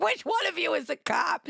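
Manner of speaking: hammed-up fan heckle; mock shout. Joking shouted fan question.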